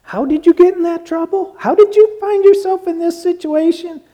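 high-pitched, sing-along questioning voice; put-on high voice of concerned puzzlement; mock questioning. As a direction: ask it high up, at normal volume. Voicing a questioner to the strayed.

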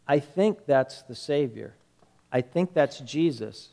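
low, hushed remark; quiet realisation. Retold or imitated. John to Peter in the boat, quiet realisation. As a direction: say it softly, as a dawning recognition.

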